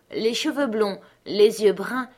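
ordinary delivery

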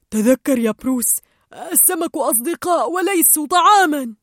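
reminding Bruce. Acted. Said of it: "وقالت شام".

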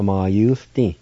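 ordinary talk, nothing marked

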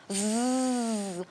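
buzzing like an angry bee. Acted. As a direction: buzz it out. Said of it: "прожужжала обиженная пчела".

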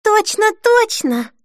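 very high, drawn-out double check; excited insisting. A narrator's reading. Denise pressing Sharon for reassurance, excited. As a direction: stretch it out, pitch soaring.

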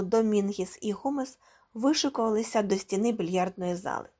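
ordinary delivery, nothing marked

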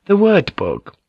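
high, light court lady voice; lady Ochiba. A narrator's reading. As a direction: speak as a sly noblewoman. Ochiba speaks, female voice.

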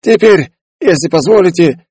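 a cosy shopkeeper inviting to dinner; Foldekai voiced.

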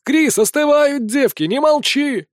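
high-pitched urging shout; crowd call-out. A narrator's reading. Tables urging the judge to speak up, calling out. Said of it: "стали выкрикивать со столов".

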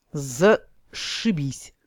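slow, gloomy drawl. A narrator's reading. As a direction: say it grimly, slowly. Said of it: "мрачно молвил гном".

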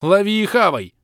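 loud, high yell of lost patience; exasperated yell. Acted. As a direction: bark it at the pest. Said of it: "Уже не выдерживал я".

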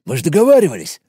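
plain reading voice

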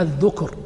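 الذكر said "adh-dhukr", u after ذ; damma on the dhal. Shown as a demonstration. Teacher reading the text to students, الذكر with damma, "dhukr". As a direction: say الذكر with a short u on the ذ. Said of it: "بضم الذال".

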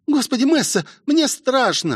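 put-on high, scared girl's voice; high anxious cry; frightened. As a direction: plead in high-pitched fear. Rosie says she is scared.

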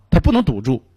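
usual speaking voice

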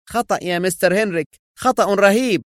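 fast, high-pitched protest; angry and agitated, pitched high; indignant anger. A narrator's reading. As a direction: burst out in outraged objection. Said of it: "في سخط وغضب".

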